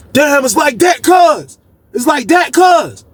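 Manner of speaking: high, betrayed calling out; hurt shout. Retold or imitated. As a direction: yell up feeling betrayed. Zoe yelling at friend in window.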